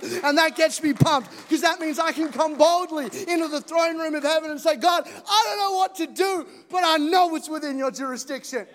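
preacher proclaiming loudly to the congregation; full-force preaching. Real, not acted. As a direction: preach it out to the church.

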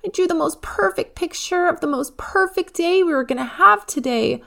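put-on high, loud child's upset wail; a little girl pleading through tears; tearful protest. A narrator's reading. Eva begging Mom after park day is cancelled, upset wail. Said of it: "Eva burst into tears".

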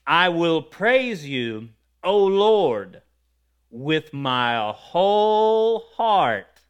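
loud, drawn-out declaration of praise; emphatic proclamation. Real, not acted. Preacher reading a psalm to the congregation, emphatic proclamation. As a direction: proclaim it slowly, stretching the big words. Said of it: "state something emphatically".

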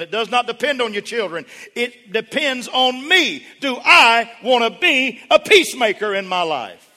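steadily climbing to a high, loud cry; full-force preaching. Real, not acted. Preacher rousing the congregation to be peacemakers, climbing to a shout.

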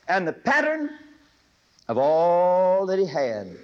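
slow, loud, rising on pattern; drawn-out scripture. Acted. Preacher dwelling on the verse for his listeners, slow and loud. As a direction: stretch every word of the verse.

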